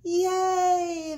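high, loud, long-held yay; crowd cheer. A narrator's reading. Crowd celebrates Big Bark's capture, cheering. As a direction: let out a drawn-out hooray. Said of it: "the crowd cheered".

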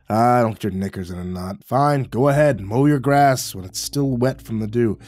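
grumble, then shrug it off sarcastically; gruff old neighbor. Acted. The neighbor needles the narrator about wet grass, sarcastic gruff old man.

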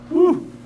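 a high breathy "whew", blown out; sudden high exhale of emotion; high whoosh of relief. Real, not acted. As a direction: exhale like you've just been moved. Presenter overwhelmed by performances.

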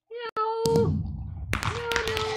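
high squeaky kitty voice as the ocelot; high cat voice. Pretend. Gamer playing an ocelot, in a high kitty voice. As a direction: meow like a cute little cat.

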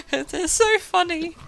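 high, loud, squealing through real laughter; can barely say it for laughing; laughing hard. Real, not acted. Helpless laughter at a game weapon.

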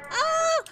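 high, loud startled yell. Real, not acted. Falls over in the game.

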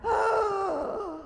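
high startled gasp; a sharp, high gasp of fright; seeing the snake in the book, a startled high gasp; gasp of shock. Retold or imitated.

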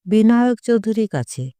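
ordinary delivery

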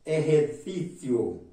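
ordinary delivery

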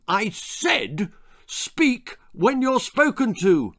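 high, slow, insistent roar; emphatic roared repeat. A narrator's reading. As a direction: hammer it out slowly as an angry father. Father repeats his roared order.

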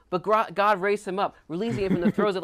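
high, loud, excited retelling. Retold or imitated. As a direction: exclaim it as thrilling good news. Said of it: "he's excited about it".

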